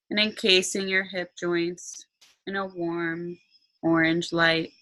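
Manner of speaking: slow meditation voice with long pauses; guided meditation. Acted. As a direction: guide them calmly into relaxation.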